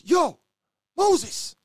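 loud, high yell across the wing; shout. Retold or imitated. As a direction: call out across the landing. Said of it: "One brother shouted".

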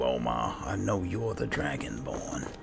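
ordinary talk, nothing marked

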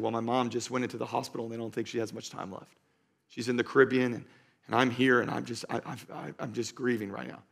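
imitating a grieving cashier, soft and low-key; hushed, halting retelling of someone's sorrow; subdued grief. Crying cashier tells a customer about her dying mom, softly voiced grief. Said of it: "she's crying".

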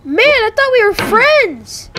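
slow, high, swooping reproach; aggrieved complaint. Real, not acted. Player to game maker after a fall, aggrieved complaint. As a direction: drag it out like a wounded friend.

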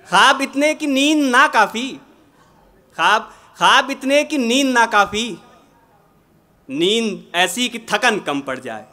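measured, projected ghazal recitation with pauses; poetry recitation. Acted. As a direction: recite the couplet to a mushaira audience.

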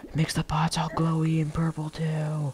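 soft breathy whisper, slowed down. Real, not acted. Gamer whispering at glowing purple pots. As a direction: hush it like a secret discovery. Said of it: "Why am I whispering?".

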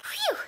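high breathy sigh of relief; relieved phew. A narrator's reading. Girl relieved after her compliment to Connor, a big phew.

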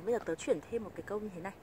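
ordinary talk, nothing marked

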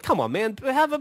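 high-pitched mock plea to the bartender; retold pleading.

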